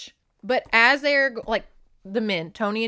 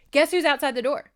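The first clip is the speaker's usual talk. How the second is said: excited, raised voice short of screaming; loud-ish teaser, pitched up; toned-down repeat; 7 semitones higher, 8 dB louder than usual. Real, not acted. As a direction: announce the reveal, reined in but still lively. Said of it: "Sorry, I'm screaming into this microphone".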